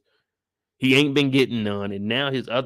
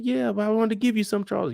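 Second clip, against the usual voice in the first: high mother voice; 9 semitones higher than usual. Retold or imitated. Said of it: "She's like".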